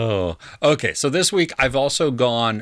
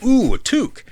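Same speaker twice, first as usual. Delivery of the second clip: mock excited; 14 semitones higher, 5 dB louder than usual. Retelling the band's delighted reaction.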